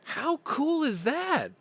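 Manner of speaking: high, loud, slow gushing; excited. Real, not acted. Gushing to listeners about the sales girl's gift, excited.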